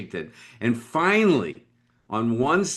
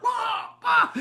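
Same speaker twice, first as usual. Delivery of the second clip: high beeping noise. Retold or imitated. Imitating Pong game beeps.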